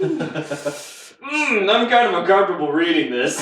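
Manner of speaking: mock squeamish, laughing; laughing awkwardly. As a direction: giggle through the embarrassed confession. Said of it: "I'm like".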